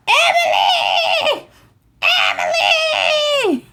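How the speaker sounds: very high, drawn-out mock holler; screaming a name. Genies hollering for a missing friend, high screaming call. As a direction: call out shrieking, stretching the name long. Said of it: "they're screaming for you".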